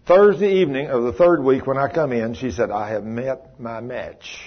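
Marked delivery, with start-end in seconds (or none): 2.7-4.4 slow, hushed admission of defeat; soft, heavy, worn-down confession; weary admission. Retold or imitated. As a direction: say it low and drawn out, beaten.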